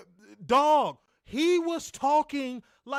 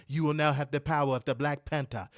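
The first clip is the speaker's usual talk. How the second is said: much lower, solemn mock-African accent; mock Wakanda accent; 11 semitones lower than usual. Mocking a wrestler's forced gimmick talk, mock Wakanda accent. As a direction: intone it like a Wakandan ruler, deep and accented. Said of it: "He had to talk like".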